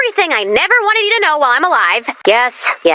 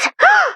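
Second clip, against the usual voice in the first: sharp excited gasp. Acted. Finds map with an X.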